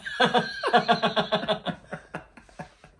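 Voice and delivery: loud, high burst of laughter; a loud, high shriek of laughter; big laugh. Real, not acted. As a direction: crack up loudly. Laughing at [name] fainting joke.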